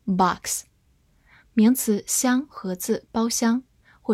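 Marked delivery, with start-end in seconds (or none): 0.1-0.7 "box" with an American open "ah" vowel; American pronunciation. Shown as a demonstration. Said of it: "美式发音". (用美式发音读“box”，元音读成张开的“啊”)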